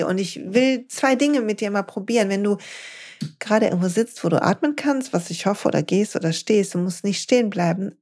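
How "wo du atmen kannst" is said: usual speaking voice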